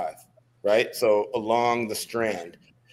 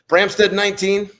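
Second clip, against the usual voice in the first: higher, louder call of the name; 8 semitones higher, 5 dB louder than usual. Retold or imitated. Instructor shouting a recruit's name on the run, loud. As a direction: belt the name out suddenly.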